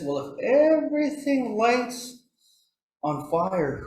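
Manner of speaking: high-pitched elder explaining. Retold or imitated. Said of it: "one of the elders".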